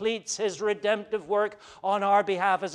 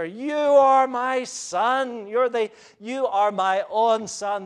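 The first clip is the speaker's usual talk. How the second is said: very slow, higher and fuller with pride; pride and delight; 4 semitones higher, 4 dB louder, slower than usual. Acted. God the Father proclaiming his own Son, overflowing with pride. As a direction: savour each word like a father bursting with pride. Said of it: "do you sense the pride of the speaker".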